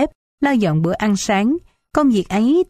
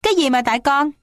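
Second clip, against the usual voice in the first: raised voice; 6 semitones higher, faster than usual. A narrator's reading. Daughter rejecting her mother's blame, raised voice. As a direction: fire back in raised pitch. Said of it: "Lựu cũng lớn tiếng".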